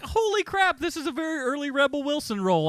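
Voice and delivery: thrilled to spot an early cameo; excited. Retold or imitated. Host recalling spotting Rebel Wilson, a high, excited exclamation. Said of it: "I was excited".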